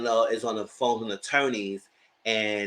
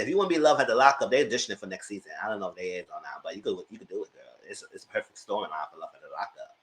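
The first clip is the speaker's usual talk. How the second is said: teasing; 7 semitones lower, faster than usual. Real, not acted. Teasing advice, fading to laughter.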